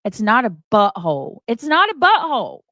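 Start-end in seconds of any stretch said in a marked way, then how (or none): 1.5-2.6 higher and louder, punching NOT; exasperated louder repeat. Real, not acted. Streamer repeats to chat it's a commercial, exasperated and louder. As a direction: hit "not" hard, fed up.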